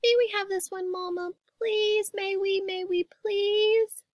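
put-on high childlike pleading; begging little sister. A narrator's reading. Sister begging Mama for the puppy, high pleading. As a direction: beg in a small child's voice.

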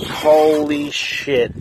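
slow, loud, drawn-out holy shit; real amazement at the size of the catch; stunned awe. Real, not acted. Huge fish on the line.

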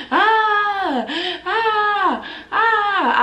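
high, mock baby wails; imitating baby crying. Teller imitating her baby son wailing, high. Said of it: "I can hear him screaming, crying".

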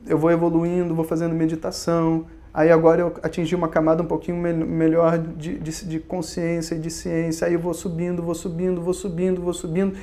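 soft, monotone recital of endless steps; mock plodding progress. Seeker listing his gradual climb in meditation, mock monotone. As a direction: drone on like a self-satisfied seeker, evenly and repetitively.

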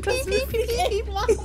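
very high playful squeak; high comic squeak; squeaky high voice. Acted. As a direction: say it in a tiny high voice.

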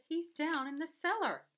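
put-on excited storybook boy; urgent report. A narrator's reading. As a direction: stretch it out in alarm. Boy directs the arriving policeman.

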